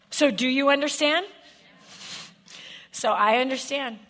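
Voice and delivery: loud, high and driving sermon voice; rising preacher's fervour; full-force preaching. Acted. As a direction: preach it to the back row.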